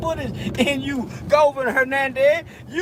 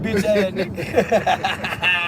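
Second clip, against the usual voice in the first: slow taunt dissolving into real laughter; laughing insult; 4 semitones lower, much slower than usual. Real, not acted. Taunting his rival while laughing at him. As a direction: drag it out while cracking up.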